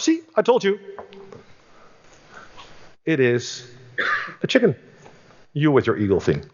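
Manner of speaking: mock the friend's eagle idea; gloating farmer.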